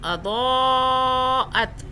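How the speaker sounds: اضاءت chanted with the vowel held six counts; long madd recitation. Shown as a demonstration. Teacher recites to show six-count stretch, chanted. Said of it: "یہ دو پڑھنا ہے".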